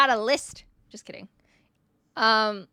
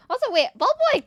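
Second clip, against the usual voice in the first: name jumps up high and louder; surprised call-out; 13 semitones higher, 6 dB louder, faster than usual. Real, not acted. Streamer spotting a loyal viewer in chat, surprised and high. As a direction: catch sight of him and call out.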